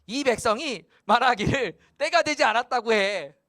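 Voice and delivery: God tattling in a huff to Haggai; sulking God. Acted. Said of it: "삐진 하나님이 말하는 거예요".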